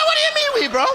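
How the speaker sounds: high-pitched, indignant comeback; incredulous protest. Acted. Nervous passenger to the whooping pilot, high incredulous protest. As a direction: squawk it in mock outrage.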